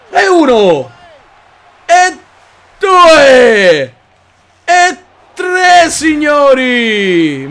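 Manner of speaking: very loud high count with long signori; shouted count. Real, not acted. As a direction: roar each number. Counting suplexes.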